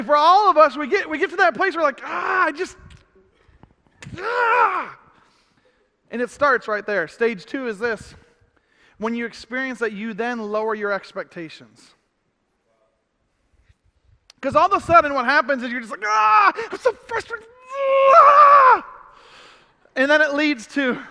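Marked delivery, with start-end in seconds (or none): 2.0-5.0 high, exasperated groan rising to a yell; fed up and at the end of your rope; choked-off frustrated outburst; frustrated cry. Retold or imitated. Acting out hitting frustration. 16.0-18.9 high, loud exasperated yell; fed-up cry building into a shout; frustrated outburst. Retold or imitated. Acting out frustrated person.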